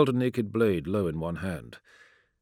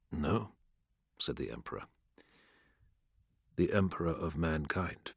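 lower, softer, measured emperor; a lightly voiced cold emperor, lower and softer; calm emperor; 4 semitones lower, 9 dB quieter than usual. A narrator's reading. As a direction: speak as an unmoved ruler passing sentence.

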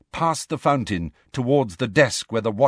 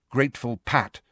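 usual speaking voice

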